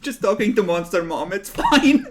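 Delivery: high, loud laughing answer; giggling cover-up. Real, not acted. Joke about Monster Mom.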